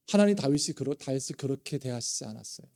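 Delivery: normal talking voice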